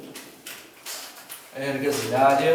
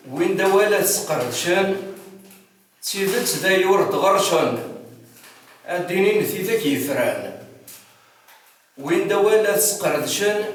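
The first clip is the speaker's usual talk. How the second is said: higher, measured poetic declamation; poem recitation; 4 semitones higher, 5 dB louder than usual. Acted. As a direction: recite each verse deliberately, pausing between lines. Recites a poem, repeating verses.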